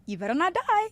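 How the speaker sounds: insistent warning, pitched high; retold pleading warning. Telling a sick friend off, insistent retold warning. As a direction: warn a friend, voice lifting sharply.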